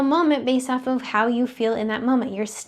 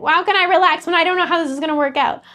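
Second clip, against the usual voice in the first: higher, louder, fast mock panic; mock panicked scream; 6 semitones higher, 6 dB louder, faster than usual. Her panicked mind protesting, a hammed-up frantic outburst. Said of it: "It wanted to scream and be like".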